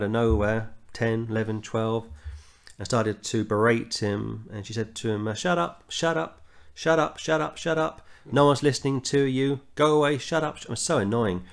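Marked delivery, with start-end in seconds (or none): 5.3-10.6 put-on high, nagging child's voice telling him off; mock angry girl. Little girl berating a street preacher, high and nagging. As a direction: play the bratty girl shouting down the preacher. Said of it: "raising her voice at him".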